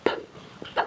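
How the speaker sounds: p popped with breath only; unvoiced p. Shown as a demonstration. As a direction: puff out the p without voice. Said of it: "p is an unvoiced sound".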